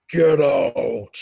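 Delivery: loud, amplified Baron roar; thunderous Get out from inside a tent; booming shout. Acted. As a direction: blast it as if through a PA. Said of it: "three times louder than a normal voice".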